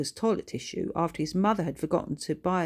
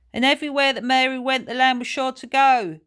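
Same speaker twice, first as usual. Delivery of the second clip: higher, louder and fast, jeering; loud mocking; 8 semitones higher, 9 dB louder than usual. A narrator's reading. Paul mocking Billy's poem, loud and fast. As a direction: drown out the poem with a nursery rhyme. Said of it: "said Paul loudly".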